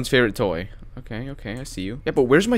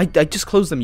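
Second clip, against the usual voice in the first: ordinary talk, nothing marked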